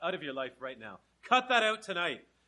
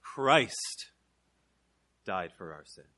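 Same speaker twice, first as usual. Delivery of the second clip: "Christ" set apart, pause, then "died for our sins" low; say it differently; 10 semitones lower, slower than usual. Shown as a demonstration. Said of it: "Let me say it a little bit differently".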